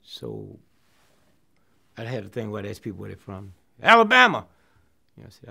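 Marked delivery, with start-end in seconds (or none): 3.8-4.5 high, loud shout from the crowd; yelled out. Retold or imitated. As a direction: call it out from the back row. Audience member yelling hometown.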